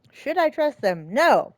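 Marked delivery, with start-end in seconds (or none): 1.1-1.5 emphatic, loud answer to the game; loud retort. Real, not acted. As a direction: push it out loud and firm. Player reacting to game choice.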